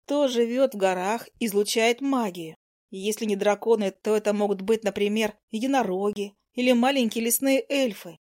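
usual speaking voice

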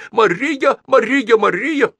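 high, loud repeated cry; the old man calls the name over and over; high, loud chant of one name; excited repeated call. A narrator's reading. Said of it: "закричал Старжин Фитц".

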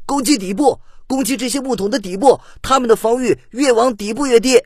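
high, loud cry of discovery; delighted surprised shout. A narrator's reading. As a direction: shout the breakthrough to everyone mid-battle. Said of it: "忽然有人惊喜地喊道".

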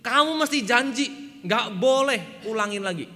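loud, high scolding; the villagers snap a stern warning at the prankster; angry warning. Retold or imitated. Angry villagers scold the lying shepherd, loud and high. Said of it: "Lalu warga desa marah".

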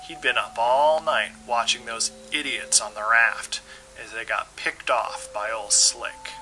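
plain reading voice

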